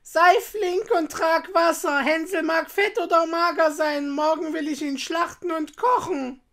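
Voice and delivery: high, loud, impatient witch voice; witch's shouted order. A narrator's reading. Impatient witch ordering Gretel to fetch water, shouting. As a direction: bark orders as a high-pitched storybook witch. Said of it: "rief sie dem Mädchen zu".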